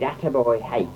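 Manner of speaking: usual speaking voice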